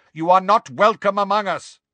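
high, loud, forceful warning; calling out. A narrator's reading. Centaur tells giant he is unwelcome, calling loudly. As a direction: warn off an intruder at volume. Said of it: "called Megorian".

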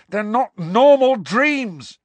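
loud, high, slow furious shout. A narrator's reading. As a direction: shout it in her face. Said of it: "Harry shouted in her face".

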